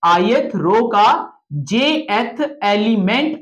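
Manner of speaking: ith रो का jth element said very slowly, word by word; slow dictation. Shown as a demonstration. Teacher repeating the reading rule to students, slowly. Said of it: "क्या पढ़ोगे?".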